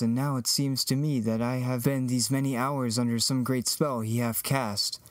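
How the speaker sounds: usual speaking voice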